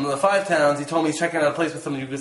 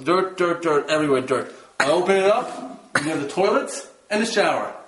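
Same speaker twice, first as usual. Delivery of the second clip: ordinary talk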